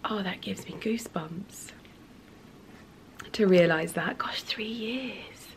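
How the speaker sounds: hushed whispered awe; whisper, overcome with feeling; very soft unvoiced whisper. Real, not acted. Moved remembering dead cat.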